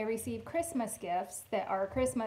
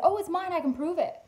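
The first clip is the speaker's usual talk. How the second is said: eager claim; 8 semitones higher, 7 dB louder than usual. Retold or imitated. Owner claiming the lost twenty.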